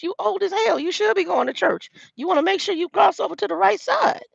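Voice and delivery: high-pitched mock scolding; retold teasing lecture, voice way up; teasing admonishment. Teasing an old man about getting to church, mock scolding.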